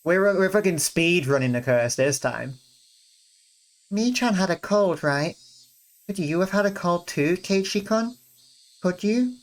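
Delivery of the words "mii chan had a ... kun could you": put-on high-pitched, gently questioning girl; high girlish character voice; concerned girl friend. Rena voiced asking after a cold.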